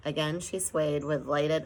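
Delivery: ordinary delivery, nothing marked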